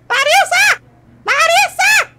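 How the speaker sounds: impatient high holler; calling out. Acted. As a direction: call across the party for her. Calling out for Larissa.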